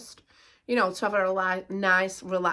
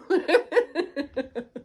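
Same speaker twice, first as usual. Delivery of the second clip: laughing; 6 semitones higher than usual. Real, not acted. Laughs at her own retort.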